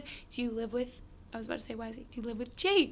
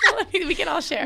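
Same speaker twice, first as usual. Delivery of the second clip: laughing; 7 semitones higher, 8 dB louder, faster than usual. Real, not acted. Laughing about shared wedding.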